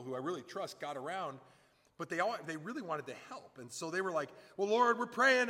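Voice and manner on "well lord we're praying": high, loud imitated prayer; loud prayer-huddle voice, acted; mock fervent prayer. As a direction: pray over someone urgently, hammed-up. Acting out earnest helpers praying.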